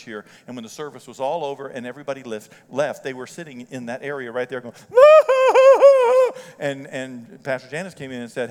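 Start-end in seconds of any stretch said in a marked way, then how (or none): 4.8-6.5 loud, very high exaggerated wail; mock wailing. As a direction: bawl like a hammed-up sobber. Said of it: "Why are we crying?".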